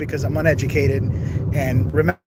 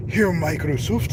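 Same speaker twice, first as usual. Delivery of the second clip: slow, put-on Chinese accent; exaggerated Chinese accent, drawn out; slower than usual. Shown as a demonstration. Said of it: "switch it to a Chinese accent".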